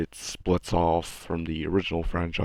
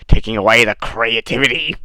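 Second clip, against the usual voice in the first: loud, raised put-on voice; loud mock outcry; 3 semitones higher, 10 dB louder than usual. Vlogger repeating the creativity complaint, loud mock outcry.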